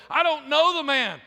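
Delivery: loud, high acted outburst; sworn denial. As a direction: swear it vehemently, as Peter denying. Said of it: "Simon Peter even cursed. He swore".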